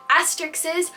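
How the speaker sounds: ordinary talk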